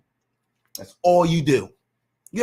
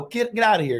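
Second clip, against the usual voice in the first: usual speaking voice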